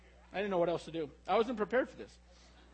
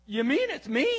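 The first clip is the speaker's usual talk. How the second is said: voice cracking; 4 semitones higher, 6 dB louder than usual. Retold or imitated. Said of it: "her voice cracks".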